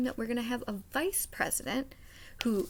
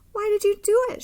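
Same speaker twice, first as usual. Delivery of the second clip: amazed question; 10 semitones higher, 12 dB louder than usual. A narrator's reading. Grace asks Sam, amazed.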